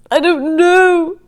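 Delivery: high, slow, near tears; wife tearful, blowing her nose; tearful, not knowing. Acted. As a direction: let it crack with tears. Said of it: "she said, blowing her nose".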